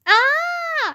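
high, held storybook scream. A narrator's reading. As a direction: cry out in high-pitched fright. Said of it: "I screamed".

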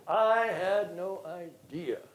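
usual speaking voice

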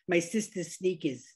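teasing Long Island imitation; Long Island accent. Host teasing friends from Long Island, Long Island accent. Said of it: "I have friends from Long Island".

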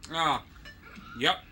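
normal talking voice

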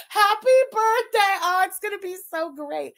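high, squealed happy-birthday cheer; gloating fake celebration; mock birthday glee.

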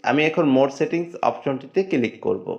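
normal talking voice

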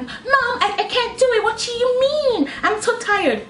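put-on high, whiny child voice; mock a whining American child; whiny American kid. Imitating a whiny American kid to her mother. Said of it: "but you'll be like".

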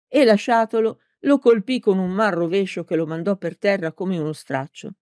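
ordinary delivery, nothing marked

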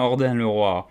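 ordinary delivery

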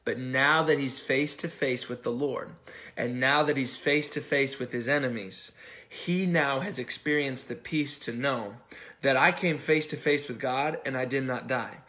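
preaching at full force. Acted. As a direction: drive it home to the congregation. Preaching builds to climax.

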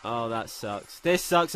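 ordinary talk

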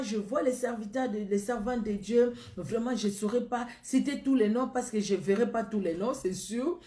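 ordinary talk

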